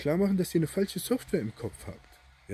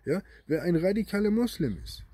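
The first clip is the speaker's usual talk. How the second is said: ordinary delivery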